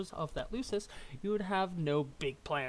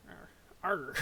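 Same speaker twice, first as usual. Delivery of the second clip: pirate growl. Pretend. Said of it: "change his accent to be a pirate".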